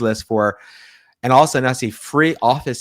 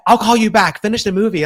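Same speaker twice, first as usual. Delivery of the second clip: high, raised voice imitating a caller; retold phone sign-off; 10 semitones higher, 5 dB louder than usual. Friend telling him to finish the movie, high and loud retold sign-off. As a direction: call it out brightly, pitched well up.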